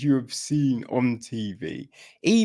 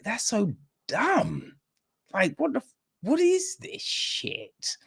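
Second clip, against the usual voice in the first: disgusted; 10 semitones higher, 4 dB quieter than usual. Retold or imitated. Viewer's disgusted reaction as quote.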